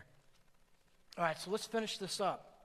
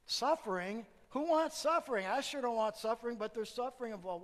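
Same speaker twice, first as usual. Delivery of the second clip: louder and higher, building fervour on suffering; rising preaching shout; 5 semitones higher, 5 dB louder than usual. Real, not acted. Preacher to congregation, questioning suffering, building to a shout. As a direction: lift the voice and hammer the word suffering.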